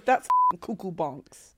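slow, lightly put-on voice; Spencer. Quoting a mutual friend's odd catchphrase, slow impression. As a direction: mimic a friend's pet phrase, drawn out. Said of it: "Spencer says".

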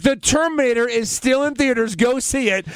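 ad read. Pretend. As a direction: rattle off the sponsor plugs. Mock Cole ad read.

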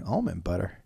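ordinary delivery

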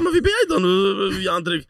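high, loud incredulous protest; mock indignant question. Player retelling his comeback to Zlatan, high incredulous protest. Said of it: "Ik zeg".

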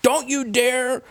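high-pitched, exaggerated outrage; mock furious fan. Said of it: "She got really mad".

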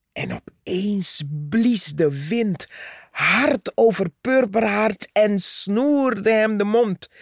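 ordinary delivery

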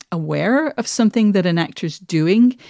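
usual speaking voice

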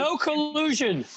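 high, slow, drawn-out mock chant; sing-song political parroting; mocking slogan. Mock slogan interjected over the report.

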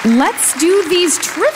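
high, drawn-out game-show call; hyped host announcement. Real, not acted. Host launches trivia for the audience, hyped announcement. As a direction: kick off the segment with showy excitement.

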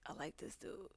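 breathless whisper of a secret crush. Retold or imitated. Retelling a first crush, whispered to a friend. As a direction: whisper it like a confession. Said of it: "I was like".

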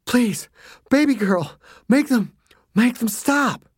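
high, panting plea; a tied-up father begs his daughter, panting; breathless begging. A narrator's reading. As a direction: beg through hard breaths. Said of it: "breathing so hard".

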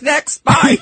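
loud, high mock dismissal; brusque boss impression. Co-host imitates boss dismissing a latecomer, brusque and loud. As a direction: imitate the boss cutting someone off loudly. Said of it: "he's like".

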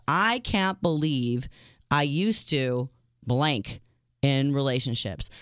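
slow, drawn-out game title; slow and deliberate. Acted. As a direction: announce it slowly, word by word. Announcing the call-in game title.

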